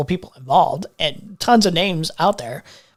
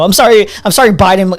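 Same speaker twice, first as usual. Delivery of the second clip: loud mock apology; 3 semitones higher, 8 dB louder than usual.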